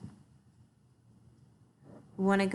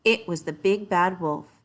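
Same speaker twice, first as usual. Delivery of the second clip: ordinary delivery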